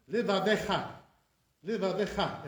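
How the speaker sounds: Levavecha in full, le-va-VE-CHA; sounded sheva. Shown as a demonstration. Teacher showing correct reading, every syllable of Levavecha sounded. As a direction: give Levavecha every syllable, clearly separated. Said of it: "It has to be".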